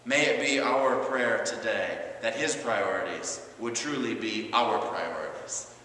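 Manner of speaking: ordinary talk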